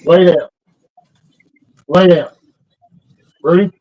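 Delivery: loud, sharp orders to a dog; firm dog command. Real, not acted.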